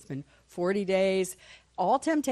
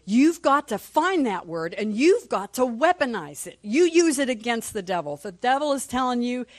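usual speaking voice

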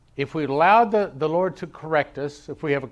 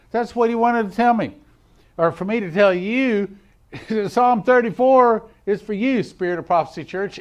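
high, loud preacher's exhortation; forceful preaching; 6 semitones higher, 8 dB louder than usual. Real, not acted. Preacher telling the church the psalm is theirs, forceful preaching. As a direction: drive it home to the listeners.

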